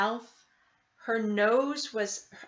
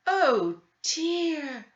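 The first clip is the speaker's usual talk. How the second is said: sadly; 6 semitones higher than usual. A narrator's reading. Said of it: "the old woman said sadly".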